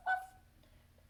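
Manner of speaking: very high little woof; dog woofing. Acted. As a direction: yap like a small dog. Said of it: "and the dog going woof".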